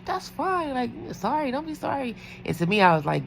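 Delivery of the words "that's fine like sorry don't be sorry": squeaky nice-nurse voice; high polite reassurance. Retold or imitated. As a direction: reassure them sweetly in a high voice. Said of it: "So I'm like".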